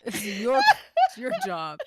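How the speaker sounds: usual speaking voice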